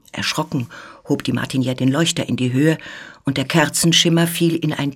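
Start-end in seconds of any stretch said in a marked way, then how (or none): none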